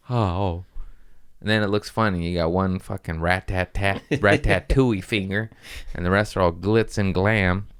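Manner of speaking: ordinary talk, nothing marked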